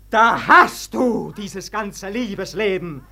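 ordinary talk

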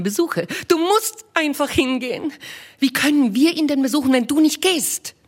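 Mrs Bennet voiced high and bright-eyed, fussing; a high, eager matron gushing to her husband; eager wife. Wife to her husband with news, eager high voice.

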